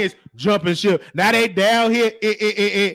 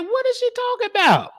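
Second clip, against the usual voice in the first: much higher put-on voice of confusion; high mock disbelief; 13 semitones higher than usual. Reacting to the fan in chat, high baffled mock voice. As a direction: squeak it out, baffled. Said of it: "I'm like".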